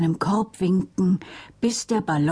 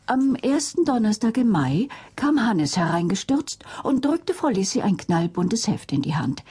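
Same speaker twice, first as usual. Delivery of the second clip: plain reading voice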